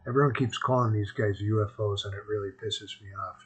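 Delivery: usual speaking voice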